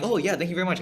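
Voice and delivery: high, eager mock thank-you; imitating mom. Mom voiced in a story.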